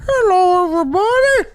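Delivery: very high, loud cartoon voice; silly talking body part. Streamer voicing a body part at the mic, squeaky cartoon voice. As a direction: squeal it as a tiny puppet. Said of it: "and made it talk".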